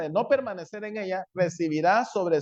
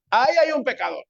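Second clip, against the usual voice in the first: high, loud accusing cry; raised voice announcing a sinner; law crying out; 7 semitones higher, 5 dB louder, faster than usual. Acted. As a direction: call out the sinner like an alarm.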